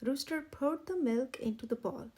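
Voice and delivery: plain reading voice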